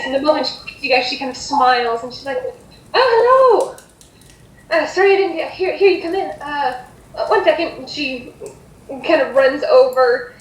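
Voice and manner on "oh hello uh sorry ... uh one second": higher-pitched, welcoming hostess voice; flustered hostess. Acted. Woman hurries to greet surprise customers, flustered and high. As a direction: greet unexpected guests, flustered and apologetic.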